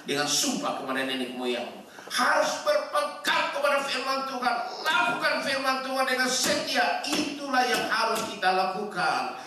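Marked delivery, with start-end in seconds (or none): 2.1-9.4 high, raised sermon voice; high-pitched pulpit delivery; full-force preaching. Real, not acted. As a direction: preach it out in rising, ringing phrases.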